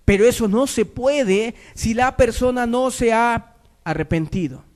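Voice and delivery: high, slow, emphatic pulpit delivery; fervent preaching. Acted. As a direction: press the warning on the congregation. Preaches at full force.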